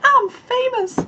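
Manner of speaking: high, breathless thrilled urging; giddy showing off. Retold or imitated. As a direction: squeal it like a kid showing a prize. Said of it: "I'm like".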